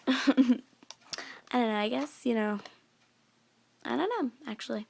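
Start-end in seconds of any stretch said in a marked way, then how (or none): none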